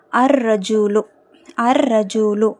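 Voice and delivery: "அர் ரஜூலு" with long rolled double R, no L; teacher's careful "அர் ரஜூலு", doubled R; lam silent. Shown as a demonstration. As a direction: hold the R in "அர் ரஜூலு", dropping the L. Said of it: "லாம் உச்சரிக்கப்படக்கூடாது".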